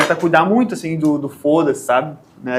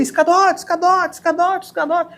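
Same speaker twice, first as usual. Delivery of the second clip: even higher repeated calling shout; imitating the coworker shouting again, higher; retold shout; 13 semitones higher than usual. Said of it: "e ele".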